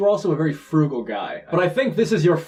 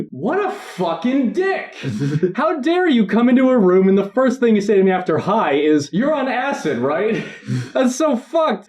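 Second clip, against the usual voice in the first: indignant rant; 7 semitones higher than usual. Retold or imitated. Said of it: "And I was like".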